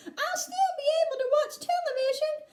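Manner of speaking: put-on very high miniature boy voice; tiny squeak. A narrator's reading. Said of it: "squeaked the tiny voice".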